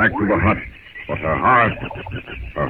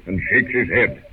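ordinary delivery

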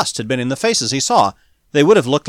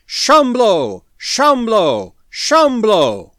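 loud, high chanted shout, repeated; mob cry; 8 semitones higher, 6 dB louder, much slower than usual. A narrator's reading. Angry mob taking up the cry, loud chanted shout. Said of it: "the mob took up the cry again".

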